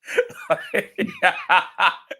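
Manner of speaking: high-pitched burst of laughter. Real, not acted. Golfer laughs about his useless lesson, high burst of laughter.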